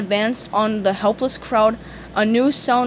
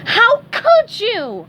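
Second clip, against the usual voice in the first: high, loud, very slow reproach; reproachful SpongeBob; 12 semitones higher, 6 dB louder, much slower than usual. A narrator's reading. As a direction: drag out the disbelief. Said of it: "he scolded".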